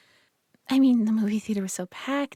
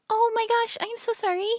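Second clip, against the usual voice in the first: startled; 9 semitones higher than usual. Acted. Caught singing, startled.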